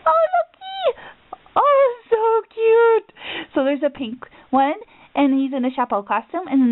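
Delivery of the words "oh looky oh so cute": very high, louder squeal, drawn out; delighted squeal. Real, not acted. To her friend over a cute charm, delighted squeal.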